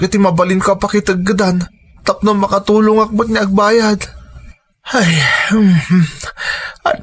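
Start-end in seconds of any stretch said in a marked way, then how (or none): 4.9-6.1 choked sobbing hums; fighting back tears as he sighs; crying. Acted. Father breaks down over debt.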